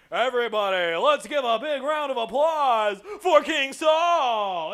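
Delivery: loud, slow hyped announcer shout; announcer introduction. Acted. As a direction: pump up the crowd like an MC. Samuel announcing king to crowd.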